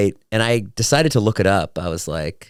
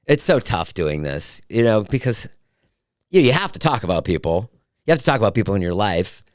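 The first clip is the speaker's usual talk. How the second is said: ordinary delivery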